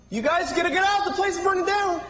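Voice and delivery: breezy cheer while telling people the place is burning; mismatched cheerful tone. Acted. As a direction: chirp out a fire warning like good news. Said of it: "doesn't know how to match his face to what he's saying".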